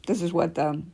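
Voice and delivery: ordinary delivery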